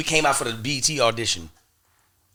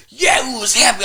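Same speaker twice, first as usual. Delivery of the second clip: happy cheer; 12 semitones higher, 8 dB louder than usual. Retold or imitated. Celebrating booking the show.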